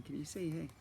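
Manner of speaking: ordinary delivery, nothing marked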